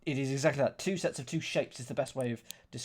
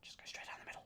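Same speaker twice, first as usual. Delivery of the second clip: whispered to himself; 18 dB quieter, much faster than usual. Real, not acted. To himself at the puzzle, whispered. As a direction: breathe it out under the breath.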